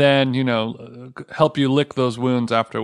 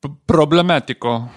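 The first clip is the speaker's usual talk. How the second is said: mock Italian; 2 semitones higher, 5 dB louder than usual. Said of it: "that's my Zac Efron impressione".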